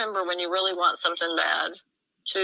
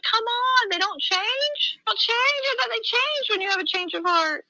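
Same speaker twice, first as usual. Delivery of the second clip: much higher, disbelieving questions; incredulous protest. Retold or imitated. Protesting to the Lord that husbands don't change, incredulous. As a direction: plead in disbelief.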